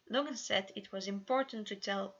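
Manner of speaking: ordinary delivery, nothing marked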